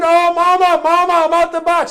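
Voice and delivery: high, loud cry to mama; screaming child. Retold or imitated. Johnny fallen out the box, screaming for Mama. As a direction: scream it as the fallen boy, acted out. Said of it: "started screaming".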